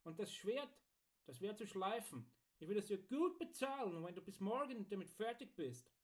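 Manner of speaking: high, animated singer giving orders; Archibald the customer. Acted.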